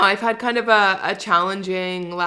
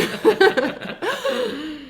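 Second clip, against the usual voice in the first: high laugh; 12 semitones higher than usual. Real, not acted. Laughing at own joke.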